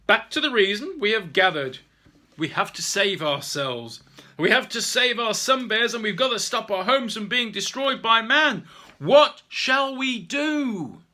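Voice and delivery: rally the clan to act; rousing speech. Acted. Mister O rallying the orangutans to save their home, loud.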